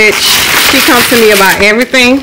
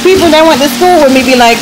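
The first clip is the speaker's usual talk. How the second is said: usual speaking voice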